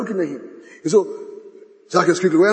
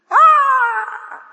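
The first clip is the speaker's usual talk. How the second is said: mocking shriek; 6 dB louder than usual. Mockers at the cross.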